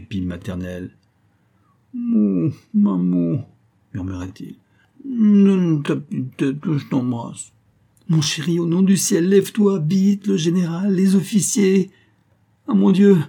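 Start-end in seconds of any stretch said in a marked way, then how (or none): 1.9-3.5 high, drawn-out little moos; sleepy calf mooing. A narrator's reading. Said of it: "il poussait de petits mugissements". 5.0-7.5 drawn-out moan sliding down from high; sleepy babbling. Acted. Husband babbling in his sleep. 8.1-13.2 loud, high-pitched panic; frantic pleading. Acted. Wife frantically waking husband before guests.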